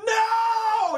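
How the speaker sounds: very high comic scream; mock screaming. Playing the screaming woman, high mock scream. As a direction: scream it out at the top of your range. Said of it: "I'm just screaming".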